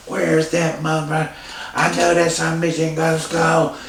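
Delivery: put-on high, slurring drunk dad. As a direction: slur it like a drunk. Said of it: "you know that drunk voice?".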